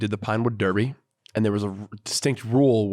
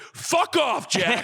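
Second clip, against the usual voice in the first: high-pitched mock outburst; heated dad; 11 semitones higher, much slower than usual. Dad brushing off his son's car design, mock heated. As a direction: imitate an exasperated dad cutting his kid off. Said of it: "got heated".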